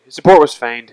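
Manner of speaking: loud, clear announcement. Shown as a demonstration. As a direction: proclaim it to the room.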